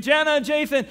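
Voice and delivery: high call down the street; calling while walking down the road; calling names. Retold or imitated. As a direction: keep calling out for them. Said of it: "He's going down the street".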